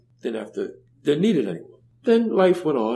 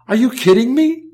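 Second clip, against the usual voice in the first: higher and louder disbelief; can you believe it, pitched up and loud; incredulous; 10 semitones higher, 7 dB louder than usual. Real, not acted. Telling the host about his parents' gift, loud amazed disbelief.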